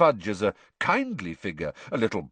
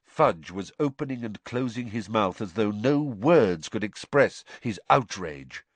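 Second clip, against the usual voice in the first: plain reading voice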